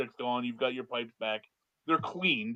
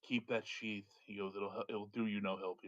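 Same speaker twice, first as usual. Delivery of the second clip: quiet wizard; 5 semitones lower, 11 dB quieter than usual. Acted. Wizard handing back sword.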